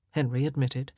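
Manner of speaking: ordinary delivery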